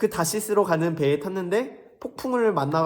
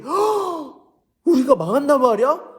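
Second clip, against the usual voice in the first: shocked Ninevites; 9 semitones higher, 6 dB louder than usual. Acted. Nineveh people hearing of their doom, shocked gasp. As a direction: gasp then much higher, louder alarm. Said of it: "이 니느웨 사람들이".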